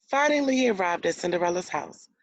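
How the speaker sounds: ordinary talk, nothing marked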